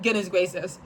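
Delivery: ordinary delivery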